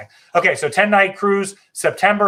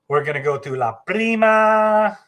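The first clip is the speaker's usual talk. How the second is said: put-on Italian accent; 5 semitones higher, slower than usual. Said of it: "I'm gonna get my Italian accent out".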